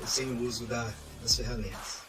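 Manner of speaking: ordinary talk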